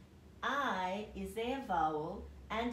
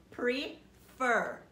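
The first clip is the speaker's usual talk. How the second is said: "prefer" drawn out, louder on the final "fer"; stress on last syllable; 5 semitones higher, 6 dB louder than usual. Shown as a demonstration. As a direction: stretch "prefer" slowly and hit "fer" hard. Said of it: "Did we stress that last syllable?".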